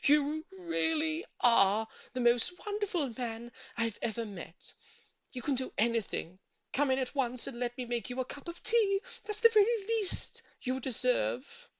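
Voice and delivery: a lady thrilled, praising her neighbour; gushing praise. A narrator's reading. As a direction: pour out grateful admiration. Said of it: "cried out".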